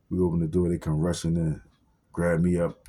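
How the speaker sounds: ordinary delivery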